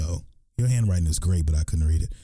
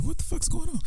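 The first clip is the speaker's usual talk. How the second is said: startled. Retold or imitated. Hears someone talking behind him.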